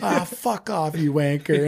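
imitating an English fan's jeer, pitched well up; a hammed-up English accent on a jeering fan; mock English accent. Fans heckling at a football game, mock English accent. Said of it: "in the English accent".

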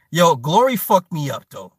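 normal talking voice

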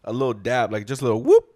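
usual speaking voice

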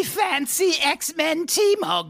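high, slow mock cockney accent; squeaky hammed-up cockney voice. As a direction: ham up a posh Brit showing off his mug.

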